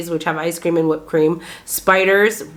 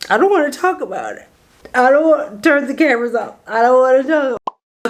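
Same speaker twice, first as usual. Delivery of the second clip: higher, quick mock-traumatised plea; hammed-up panicky refusal; mock distress; 6 semitones higher, faster than usual. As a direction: play up being too upset to discuss it. Mock distress over road trip memory.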